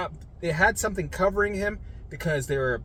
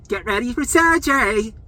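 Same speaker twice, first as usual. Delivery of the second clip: loud, high singsong mock call; rousing announcement; 9 semitones higher, 6 dB louder than usual. Staff to a groggy patient before surgery, rousing. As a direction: imitate staff hollering someone awake. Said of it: "That was me and them going".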